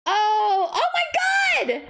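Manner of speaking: high, slow excited yell; real burst of delight at a card; thrilled shout at the pull. Real, not acted. Opening a pack, sees big card.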